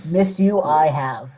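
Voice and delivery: ordinary talk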